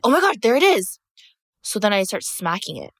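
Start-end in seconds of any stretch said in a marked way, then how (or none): 0.0-1.0 excited find. Retold or imitated. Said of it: "And I'm like".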